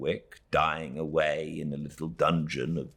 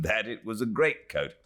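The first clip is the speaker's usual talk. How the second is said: ordinary delivery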